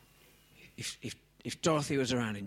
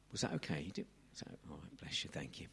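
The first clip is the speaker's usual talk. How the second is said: hushed aside; 9 semitones lower, 13 dB quieter, faster than usual. Real, not acted. Aside to Dorothy off mic.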